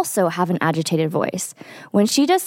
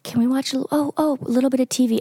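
put-on higher, pleading little-kid ask; child's asking voice; 5 semitones higher, faster than usual. Mom imitating her daughter begging for TV, lightly childlike. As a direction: coax like a small child wanting TV. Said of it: "She's like".

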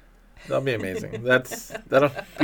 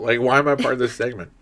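ordinary delivery

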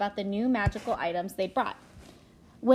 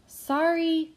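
calling down loudly, higher; 8 semitones higher, 6 dB louder than usual. A narrator's reading. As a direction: call it down over the railing. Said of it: "she called out to the pedestrians below".

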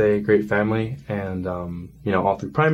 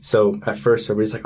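ordinary talk

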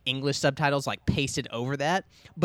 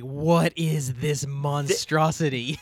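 slow, loud, drawn-out mock horror; appalled disbelief; 5 dB louder, much slower than usual. Podcaster recalling first lifting a huge book, appalled. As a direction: stretch it out in hammed-up dismay.

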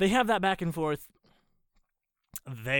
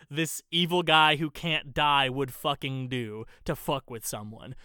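ordinary delivery

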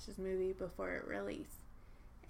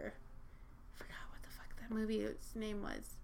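whispered aside; 8 dB quieter than usual. Real, not acted. Unvoiced aside about forgetting.

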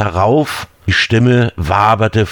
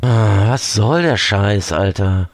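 miffed. Acted. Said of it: "der ihn pikiert ansah".

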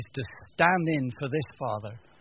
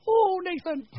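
mock gushing; 4 dB louder, slower than usual. Mock gushing affectionate touch.

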